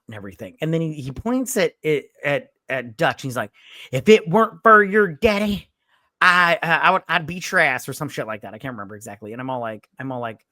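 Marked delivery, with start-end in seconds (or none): 3.9-7.9 mock threat. Truck driver threatening Doyle.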